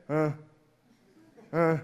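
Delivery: drowsy, noncommittal grunt; sleepy, minimal acknowledgement; half-asleep grunts. Retold or imitated. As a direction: answer barely awake. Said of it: "when they're about to fall asleep".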